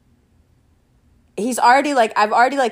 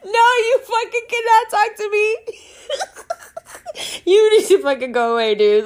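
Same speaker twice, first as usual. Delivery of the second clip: laughing refusal; 12 semitones higher than usual. Retold or imitated. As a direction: shout him off through laughter. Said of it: "I was like".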